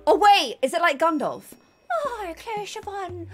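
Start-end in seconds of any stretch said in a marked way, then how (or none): 2.0-3.3 slow, soft Irish lilt; Irish accent. Acted. Wizard greeting the player slowly, soft Irish accent.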